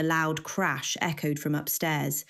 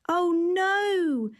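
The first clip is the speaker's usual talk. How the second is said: much higher, mock sympathetic woman; sarcastic high voice; 13 semitones higher, 3 dB louder than usual. A narrator's reading. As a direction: fake pity in a high voice.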